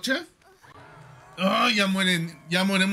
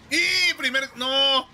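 alarmed shout; 7 semitones higher, slower than usual. Real, not acted. Killer catches first teammate.

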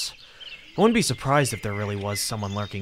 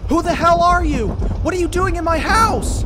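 high, loud furious yell at an intruder; angry shout; 8 dB louder than usual. Acted. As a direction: scream at the stranger in your home. Confronting intruder in house.

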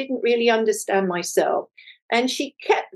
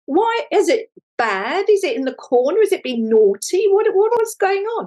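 high, playful worried questions; mock alarm; 8 semitones higher than usual. Mentor teasing the artist about her rolled canvas, high mock alarm. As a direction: fuss over the canvas like a naughty child.

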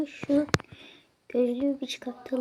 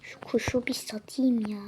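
usual speaking voice

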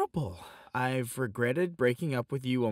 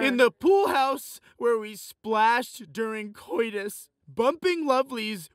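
passionate recital; 8 semitones higher, 5 dB louder, slower than usual. Acted. Poem building to passion.

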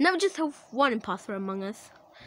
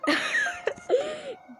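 surprised laugh. Real, not acted. Surprised by death.

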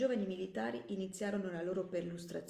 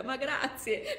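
usual speaking voice

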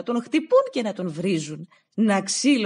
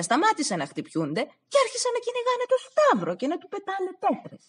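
usual speaking voice

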